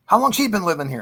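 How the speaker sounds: high, loud imitation of startled disbelief; retold alarm. Boyfriend questioning Dad about the man upstairs, alarmed.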